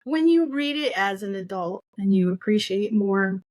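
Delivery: ordinary talk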